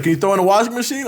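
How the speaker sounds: high, fast pleading client; mock client asking. Mimicking the Indian client begging for a washing machine, high and fast. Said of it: "It's like".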